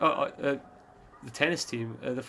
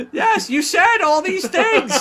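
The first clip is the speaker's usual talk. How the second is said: slow, loud, high mock accusation; hammed-up squealing interviewer; shrieking girl reporter; 9 dB louder, slower than usual. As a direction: wail it high and loud, laughing. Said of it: "this is a perfect voice for her".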